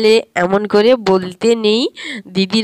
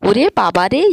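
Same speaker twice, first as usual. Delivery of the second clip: normal talking voice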